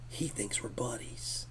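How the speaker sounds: soft, unvoiced, slow whisper; whispered aside. Real, not acted. Guest whispers his amazement to the host, slow whisper. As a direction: whisper it so he won't hear.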